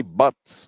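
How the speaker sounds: "butts" for "buzz", ending in TS. Shown as a demonstration. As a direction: say "buzz" as "buts". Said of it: "And we don't say".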